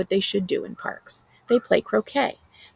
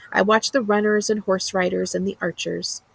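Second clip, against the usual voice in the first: ordinary delivery, nothing marked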